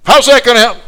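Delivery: high, loud interrupting cry; exasperated protest. Retold or imitated. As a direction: burst in, upset and disbelieving. Acted upset woman protesting again.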